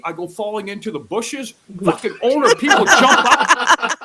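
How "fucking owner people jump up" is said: telling it through laughter; laughing. Real, not acted. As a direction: laugh while you push the words out.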